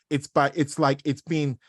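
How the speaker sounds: normal talking voice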